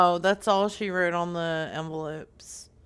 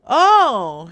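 loud, high, drawn-out realization; put-on shock; 4 semitones higher, 12 dB louder than usual. Caller learning Brenda was his wife, put-on shock. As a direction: burst out in exaggerated sympathy.

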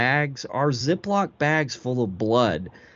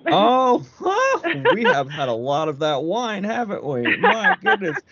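laughing; 9 semitones higher than usual. Pretend. Caught out, laughing on the call.

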